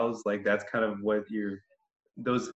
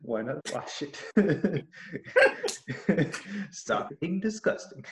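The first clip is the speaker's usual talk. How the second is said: laughing; 7 semitones higher than usual. Pretend. Mock ad, laughing.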